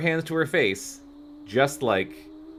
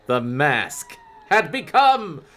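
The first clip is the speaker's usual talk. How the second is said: slow build to a loud high cry; dramatic reveal; 10 semitones higher, 7 dB louder, much slower than usual. Acted. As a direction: hit the twist like a horror trailer. Dramatic chapter cliffhanger.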